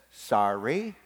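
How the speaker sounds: low, grudging mumble of a sorry, lifting at the end; insincere apology. Acted. Boy forced to apologize to his sister, grudging. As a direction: apologize without meaning it. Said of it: "Say it like you mean it".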